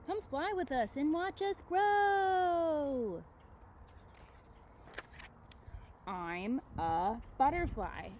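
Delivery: put-on high, slow, sing-song read to children; slow, high storybook delivery. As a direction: read it like a story for little ones. Reading the book's title line.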